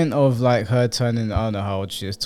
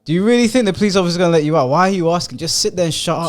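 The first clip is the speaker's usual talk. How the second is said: louder, faster, exasperated telling-off; louder, rushed repeat of the question; angry scolding; 6 semitones higher, 5 dB louder, faster than usual. Real, not acted. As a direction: snap at her to sit down and shut up. Berates the girl in the video.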